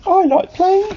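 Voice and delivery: very high cartoon voice; eager high-pitched banana; banana character voice. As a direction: pipe up high, keen to play. Betty the banana speaks.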